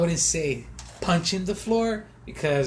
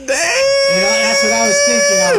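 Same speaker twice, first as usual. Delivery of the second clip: much higher, louder, almost yelling; excited shout; 13 dB louder than usual. Real, not acted. Runner telling a friend about his race, shouting excitedly.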